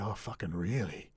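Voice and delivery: dry, mocking disbelief; sarcastic. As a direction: scoff at the idea. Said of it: "In my most sarcastic voice ever".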